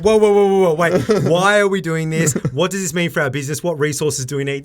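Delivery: high, loud flustered whoas; hammed-up alarmed business partner; mock panic. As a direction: play the alarmed partner stopping things. Imitating cautious Ben reacting.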